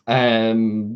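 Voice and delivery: ordinary delivery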